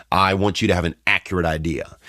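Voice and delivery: usual speaking voice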